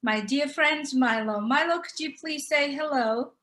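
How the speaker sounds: normal talking voice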